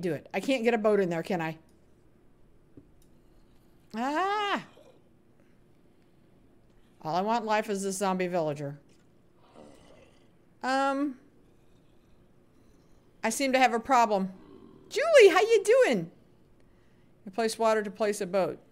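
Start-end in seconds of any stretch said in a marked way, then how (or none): 4.0-4.6 high, drawn-out "ah" of frustration; exasperated high yelp; frustrated cry. Real, not acted. Frustrated at failing boat placement. 14.9-16.1 high, loud, drawn-out hello; squealed greeting to a friend; excited greeting. Real, not acted. Greeting a viewer arriving.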